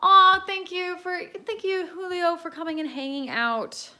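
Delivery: high, loud, squealing thank you; gushing thanks. Real, not acted. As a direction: gush over the viewer, up high. Thanking a viewer on stream.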